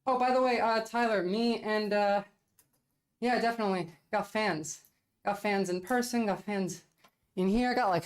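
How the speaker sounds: usual speaking voice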